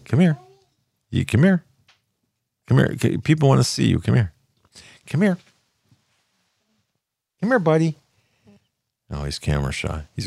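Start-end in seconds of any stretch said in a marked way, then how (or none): 0.1-8.0 high, sing-song coaxing call. Real, not acted. Grandpa coaxing shy grandson to the camera, high sing-song.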